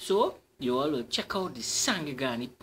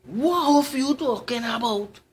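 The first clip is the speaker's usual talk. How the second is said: high, loud startled cry; frightened yelp, much higher and louder; 8 semitones higher, 8 dB louder than usual. Acted. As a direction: jump in alarm at the word hoof. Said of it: "in fright".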